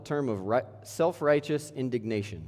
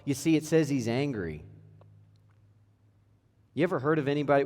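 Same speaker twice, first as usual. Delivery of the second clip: ordinary talk, nothing marked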